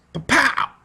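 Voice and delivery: high mock blast "Pa-pow"; gun sound effect. Teacher acting out new weapons, mock gunshot. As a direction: fire off a cartoon gunshot. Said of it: "sound of better weapons".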